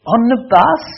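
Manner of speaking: high-pitched imitation of a startled woman; incredulous question. Russian teacher answering his concert suggestion, high and incredulous. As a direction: query it in high disbelief.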